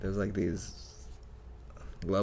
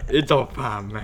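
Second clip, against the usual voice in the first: put-on Jamaican accent. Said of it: "Are you, like, Jamaican now?".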